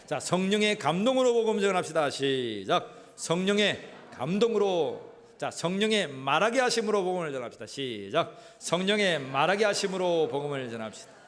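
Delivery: loud congregational chant-leading; rallying call. Real, not acted. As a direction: call it out for the crowd to repeat, loudly. 회중에게 따라 외치게 함. (큰 소리로 선창하는 구호)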